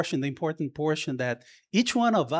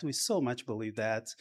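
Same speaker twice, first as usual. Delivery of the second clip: softer, hushed repeat of so much; soft echo; 5 semitones lower, 10 dB quieter than usual. Real, not acted. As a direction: let "so much" sink in quietly. Repeats line for emphasis.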